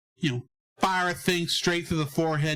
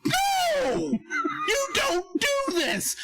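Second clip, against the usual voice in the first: high, slow, screaming no; anguished scream; much slower than usual. Retold or imitated. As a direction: scream at the screen in horror. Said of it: "I'm going".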